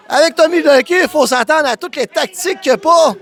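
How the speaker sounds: normal talking voice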